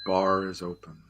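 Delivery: slow, deep show opener; slow announcer cue. Acted. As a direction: announce it slowly and grandly. Show opening cue line.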